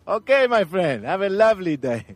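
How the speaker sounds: high, loud, cheery policeman send-off; Tanzanian policeman. Retold or imitated. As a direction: put on a friendly African cop voice. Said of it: "And he goes".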